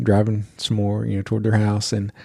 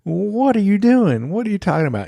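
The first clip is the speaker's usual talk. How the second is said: exasperated dad, imitated; 11 semitones higher than usual. Dad turning round to his silly daughter, high and exasperated. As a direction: scold a child in incredulous dismay. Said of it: "her dad turns around".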